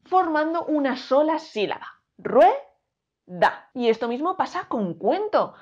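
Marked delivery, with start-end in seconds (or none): 2.2-3.7 Rue, da in two syllables, rue stressed; syllabified. Shown as a demonstration. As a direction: punch rue as one beat, then da. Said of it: "La u y la e están unidas".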